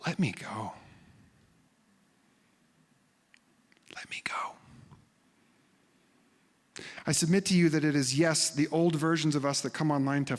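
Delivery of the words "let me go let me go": soft unvoiced whisper; hushed whisper of surrender; whispered release. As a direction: breathe it out gently, letting go. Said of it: "What if it's more like this?".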